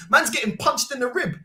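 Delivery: ordinary talk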